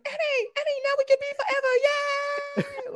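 very high, giddy cartoon squeal; goofy falsetto celebration; Jar Jar Binks. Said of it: "comes back as a Force ghost".

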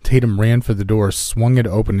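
plain reading voice

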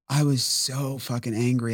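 normal talking voice

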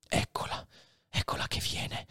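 see death coming and whisper it; whispered dread. Acted. Dying Faria sees death approaching, whispered.

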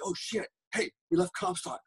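high, held-back mock call; imitated distant soldier. Soldier realizing a man was left behind, high mock call. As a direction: call out high, without full volume.

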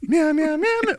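high mock meows from a grown man; cat meowing. Mocking a footballer at a fan event, high cat meows. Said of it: "are you meowing like a cat".

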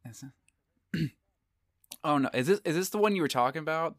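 ordinary talk

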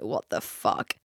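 low, quiet mock disbelief; imagined baffled disbelief. Imagined ancestors looking at her, low baffled disbelief. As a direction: drop right down, as an appalled onlooker.

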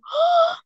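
re-enacted shocked gasp, high; high gasping scream. Retelling her reaction at the mall, high gasping scream. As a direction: let out a high startled cry. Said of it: "yo empecé a gritar allí".

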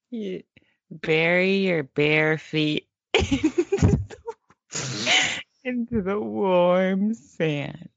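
slow meditation voice cracking into giggles; mock guided meditation. Host guiding co-host into sand, slow and giggly. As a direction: draw it out, fighting laughter.

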